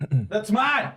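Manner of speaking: put-on high, loud childish protest; indignant yell. Disarmed thug protests his stolen stick, loud. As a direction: cry out like he lost a toy. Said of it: "he's like".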